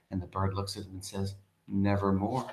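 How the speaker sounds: soft, low, eerie Nevermore; hushed raven. Acted. Teacher voicing the raven to her class, hushed and low. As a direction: let the raven answer almost under the breath.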